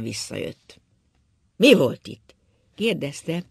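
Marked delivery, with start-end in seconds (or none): 1.6-2.3 gruff, loud question; a rough, loud drunken demand. A narrator's reading. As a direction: bark it out roughly like a drunk old man. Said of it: "azon a durva hangon".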